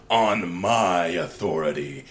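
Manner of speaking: low, slow, looming demon voice; menacing demon. Retold or imitated. The giant demon silencing the rival warlord, low and slow. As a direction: let it fall heavy and low from above. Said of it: "the menacing shadow over Thankiel says".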